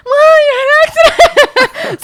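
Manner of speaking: very high, loud, drawn-out shriek with laughter; mock panicked scream. Sister hearing of the accident, hammed-up panicked shout. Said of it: "So she starts panicking".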